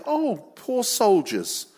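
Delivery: high, drawn-out pitying coo; mock sympathy. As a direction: fuss over them in mock concern. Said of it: "I imagine it was like this".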